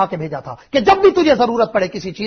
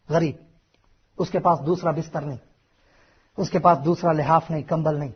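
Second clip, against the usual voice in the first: tender; 4 semitones lower, 5 dB quieter than usual. Acted. Tender story of poor mother.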